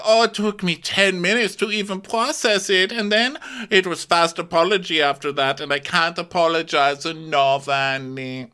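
high, accented mock apology; hammed-up, emphatic excuse-making; Diana. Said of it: "So she's like".

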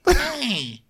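tiny, very high greeting; little crab. Acted. Crab tapping the man's chin, tiny high voice. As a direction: squeak a cute little hello. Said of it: "It's like".

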